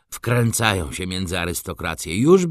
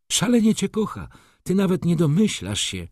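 eager insisting; 11 semitones higher, 4 dB louder than usual. A narrator's reading.

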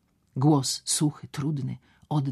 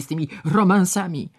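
"romansami" groaned louder and higher; 4 semitones higher, 6 dB louder than usual. Acted. Doctor's second exasperated "romansami", groaned louder. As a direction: push "romansami" harder in a groan. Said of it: "jęknął".

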